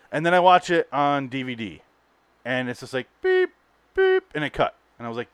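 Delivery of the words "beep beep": high, short beeping alarm; imitated truck beep.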